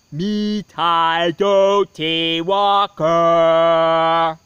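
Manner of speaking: loud, slow, drawn-out spooky chant; loud eerie moan. A narrator's reading. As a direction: boom it from the fireplace. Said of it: "the voice was getting louder".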